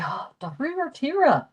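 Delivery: high-pitched reaction to good news; acted surprise, pitch leaping up; pleasantly surprised. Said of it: "good news that surprises you".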